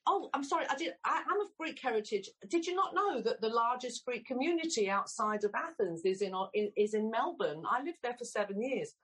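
laughing a little while fibbing in a put-on accent; fake Australian accent. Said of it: "All lies".

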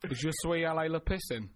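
schoolboy French with an English accent. Shown as a demonstration. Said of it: "you do it in your own accent".

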